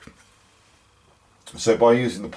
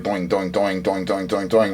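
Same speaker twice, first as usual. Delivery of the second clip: pulsing sound effect. Shown as a demonstration. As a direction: mimic a pulsing hum. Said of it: "sorry about the sound effects".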